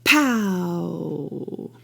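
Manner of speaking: explosive start, trailing off; impulse accent. Shown as a demonstration. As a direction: burst on the attack of POW, then a long fading tail. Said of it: "I like to vocalize an impulse as".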